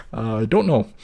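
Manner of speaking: usual speaking voice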